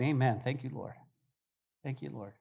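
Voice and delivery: quiet thanks. Acted. Mocked believer walks away praying.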